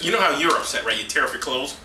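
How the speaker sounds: ordinary talk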